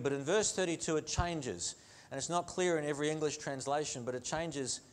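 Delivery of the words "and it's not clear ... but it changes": normal talking voice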